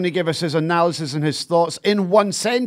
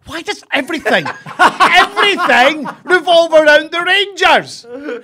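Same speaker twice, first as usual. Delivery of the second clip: exasperated; 8 semitones higher, 4 dB louder than usual. Real, not acted. Exasperated rant at co-host.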